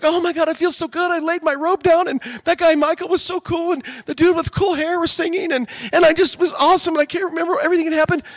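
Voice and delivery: high-pitched, breathless-with-joy churchgoer imitation; mock gushing. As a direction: gush on and on after a great service. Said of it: "and we're like".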